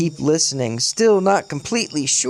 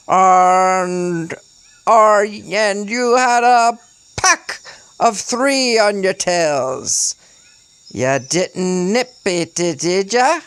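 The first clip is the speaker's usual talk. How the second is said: slow, stretched-out British declamation; halting, over-the-top put-on British; forced British accent; 5 semitones higher, 4 dB louder, slower than usual. A narrator's reading. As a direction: draw it out in a hammy British accent. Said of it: "His accent was forced and over the top".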